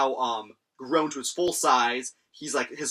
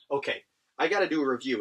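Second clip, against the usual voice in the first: usual speaking voice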